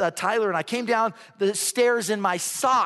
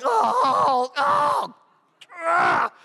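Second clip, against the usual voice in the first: loud, high comic groans of agony; pained groans; 8 semitones higher, 4 dB louder, much slower than usual. Retold or imitated. Preacher replaying his fall down ten stairs, mock agony groans. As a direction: groan loudly in pain, higher each time. Mimics lying hurt after fall.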